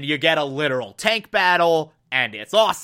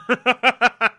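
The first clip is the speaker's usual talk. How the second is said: laughing; 6 semitones higher than usual. Real, not acted. Laughing at stabbing scene.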